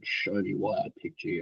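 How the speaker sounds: mock a coach daring you quietly; low challenge. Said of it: "he was just like".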